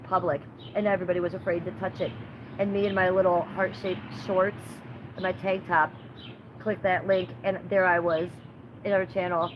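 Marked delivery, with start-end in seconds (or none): none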